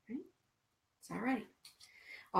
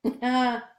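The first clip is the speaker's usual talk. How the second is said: a real burst of laughter, higher and louder; bright laugh; 6 semitones higher, 7 dB louder than usual. Real, not acted. Laughing with her live viewers, a real burst of laughter. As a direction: laugh out loud.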